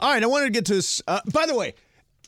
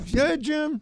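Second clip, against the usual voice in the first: high-pitched mock commentator; put-on high sportscaster voice; Tony Romo impression; 10 semitones higher than usual. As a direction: imitate an overeager announcer, voice pitched up. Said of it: "he's just like".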